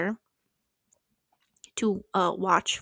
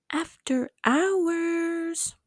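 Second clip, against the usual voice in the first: "After hours" stretched very slow and higher; "After hours" said lazily slow, pitched up; drawn out; 8 semitones higher, much slower than usual. Shown as a demonstration. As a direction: drag out "After hours" slowly, each syllable long.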